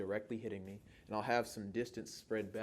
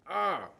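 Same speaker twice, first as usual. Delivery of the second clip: loud, higher-pitched "ah"; short raised yelp; frustrated outcry; 9 semitones higher, 10 dB louder than usual. Real, not acted. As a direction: cry out under time pressure. Time pressure while memorizing.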